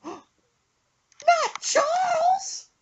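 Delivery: very slow, high crying falsetto; mom's despairing cry. Retold or imitated. Son imitating mom hearing another fish died, slow high sob. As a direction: drag out the name in a high sob.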